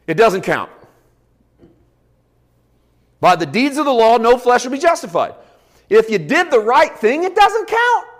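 loud, high-pitched pulpit delivery; forceful preaching. Real, not acted. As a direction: preach it hard, driving every phrase home.

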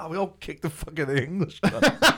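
loud, high, cheerful retelling breaking into laughter; acted gleeful kid reporting in; laughing boast. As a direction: blurt it proudly, then crack up. Acts cheerful answer to Mum.

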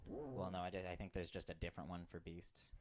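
ordinary talk

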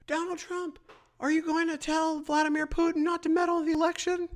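high, far-off mocking reporter voice. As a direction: squeak it out like a prissy reporter. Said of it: "somebody from the media goes".

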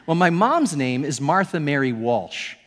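usual speaking voice

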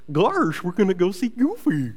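lightly voiced cartoon goof; Goofy voice. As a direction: play the lovable dopey dog for the kids. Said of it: "that's a voice I can do".

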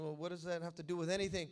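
ordinary delivery

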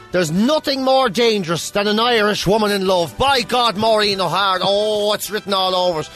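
normal talking voice

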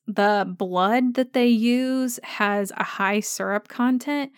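ordinary delivery

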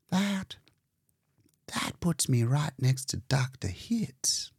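a light whisper of awe; humble, blushing. Acted. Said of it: "she blushed and became humble".

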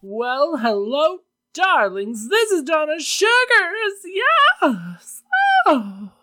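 high, showy drag diva voice; campy drag queen. Acted. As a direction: ham it up like a drag queen host. Podcast host persona intro.

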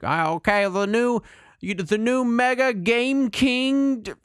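high, slow, excited nerd voice; Patton Oswalt nerd voice. Retold or imitated. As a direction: gush like an overexcited nerd. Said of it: "that was almost a perfect Patton Oswalt, uh, nerd voice".

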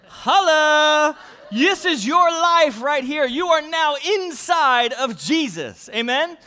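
high, loud celebration; jubilant shout. Acted. Pastor celebrating to the congregation, high and loud. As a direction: erupt in joy.

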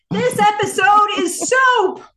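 high, loud, drawn-out theatrical yell. Acted. Host yelling a listener's verdict on the episode, mock-outraged shout. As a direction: proclaim it at full volume, stretching each word. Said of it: "I apologize for yelling at you".

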